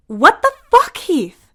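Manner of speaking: high, loud startled outburst; startled blurt. Acted. Rain startled by Heath poking her, loud shocked blurt. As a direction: jump and blurt it out. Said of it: "Rain blurts out".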